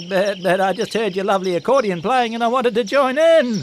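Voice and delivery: high, hurried, stammering sheep voice; flustered sheep. Acted. Barney the sheep explaining he only wanted to join in, flustered. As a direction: stammer out an eager excuse.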